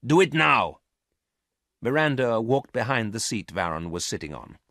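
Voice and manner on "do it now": sharp, loud command; barked order. Acted. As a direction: snap the order out. Garth orders search.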